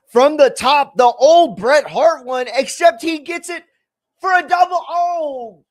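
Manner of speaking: high, loud hype call; excited. Real, not acted. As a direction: yell it like play-by-play. Excited call of a big move.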